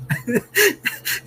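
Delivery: squealing, breathless laughter; high helpless laugh. Real, not acted. Streamer cracks up at a chat joke, high squealing laugh. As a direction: lose it completely at the joke.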